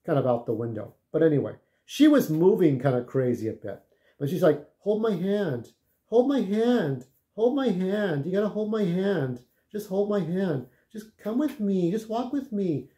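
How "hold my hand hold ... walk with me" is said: pleading woman. Retold or imitated. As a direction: coax him along like she's spaced out. Retold druggy woman pleading.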